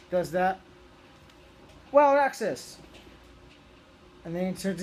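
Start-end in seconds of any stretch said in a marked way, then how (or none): 1.9-2.8 loud, high heroic battle cry; shouted transformation call. Retold or imitated. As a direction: yell it like a Ranger morphing. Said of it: "So Merrick tears it off".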